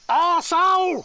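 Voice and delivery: high, full-throated shout; yelled insult. Acted. Railway worker yelling abuse at the departing bell ringer. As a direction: scream it after him.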